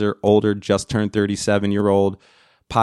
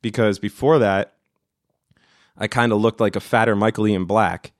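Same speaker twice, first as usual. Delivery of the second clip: normal talking voice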